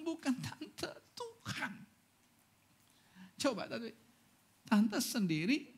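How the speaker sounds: high, crying plea that it was God; a pastor, near tears, telling an old widow it was God; tearful correction. Retold or imitated. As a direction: choke up as you tell her it was not her.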